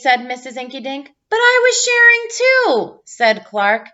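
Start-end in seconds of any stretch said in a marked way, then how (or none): 1.3-3.0 put-on high, loud kid protest; whiny protest. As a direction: plead your case loudly, much higher. Clark protests to teacher.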